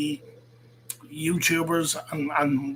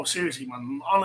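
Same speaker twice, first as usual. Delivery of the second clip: ordinary talk, nothing marked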